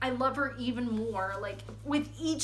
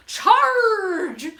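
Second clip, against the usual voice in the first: long, high, loud yell; screamed battle cry; 8 semitones higher, 11 dB louder than usual. Acted. Professor leading galloping desks, screamed battle cry. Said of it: "they heard her scream".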